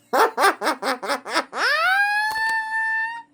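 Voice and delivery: long cackle ending in a high squeal; real helpless high laughing fit; laughing to tears. Real, not acted. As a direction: laugh until you could cry. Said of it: "laughing in tears".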